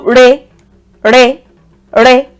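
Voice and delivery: "re" held on a level middle pitch. Shown as a demonstration. Teacher contrasting the re tone with do. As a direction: say "re" one step up and level. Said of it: "Re is a bit higher than do".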